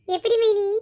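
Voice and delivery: high, loud tearful cry. Acted. Bride crying happy tears to her sister-in-law, loud and high. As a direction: sob the words out, overwhelmed with joy. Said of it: "ஏமா இப்படி அழுவுறா?".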